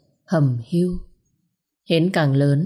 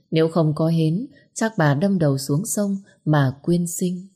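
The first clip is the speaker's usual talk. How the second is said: ordinary delivery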